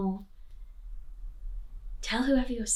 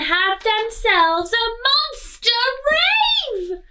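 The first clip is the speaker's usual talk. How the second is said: excited; 13 semitones higher, 7 dB louder, slower than usual. A narrator's reading. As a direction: pitch soaring with a laugh on rave. Climax of party, laugh on rave.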